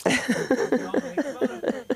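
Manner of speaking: loud, slow mocking jeer.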